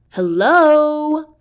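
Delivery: sing-song provoking hello; annoying tone. Acted. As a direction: make it as grating as you can. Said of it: "in the most annoying tone I can muster".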